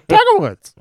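high Scottish first-tee announcer; Ivor Robeson impression. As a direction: call out the golfer's name in a high announcer voice. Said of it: "Ivor Robeson impersonation".

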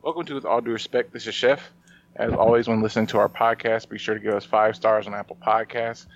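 ordinary talk

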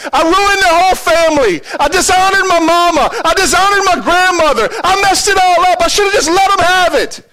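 high, loud crying out to God; anguished hollering. Retold or imitated. Preacher reenacts crying out to God in his truck, anguished hollering. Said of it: "I start hollering at God".

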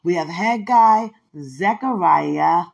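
loud, deliberate recital of the prophets; proud Bible-class recital; recitation. Acted. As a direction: call each book out clearly, like a drill.

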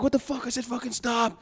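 high-pitched mock cop outrage, held back in volume; imitated angry yelling. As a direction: act out an incensed cop without really shouting. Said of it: "he's just yelling at her".

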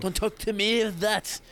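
hammed-up indignation; mock indignant. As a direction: pretend offended lady.

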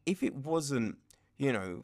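usual speaking voice

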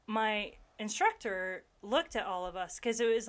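normal talking voice

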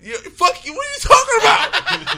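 loud laughing protest; mock outrage. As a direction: yell it half joking, laughing.

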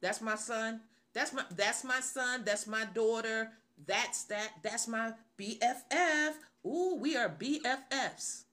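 lively, proud showing off; confident bragging. Acted. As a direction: gush proudly, building in energy. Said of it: "We say those things".

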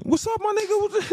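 high-pitched, suddenly friendly hello; mock friendly greeting. Local man greeting the stranger he stared at, suddenly friendly. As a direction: leap up in pitch into a retold greeting.

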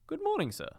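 very high sing-out greeting; jolly street greeting, pitched right up; cheery passer-by. Acted. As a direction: call a good-humoured hello in passing. Good-humoured passers-by greet Scrooge.

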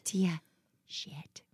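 put-on whispered, naughty little-kid "shit"; child's whisper. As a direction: sneak the bad word in a whisper. Five-year-old whispering naughty word.

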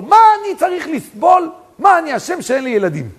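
high, loud, aggrieved crying out from a dream; a dead man's wronged yell, pleading why; shouting his complaint to the sister in her dream; anguished shout. Retold or imitated. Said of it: "והוא צועק לי".

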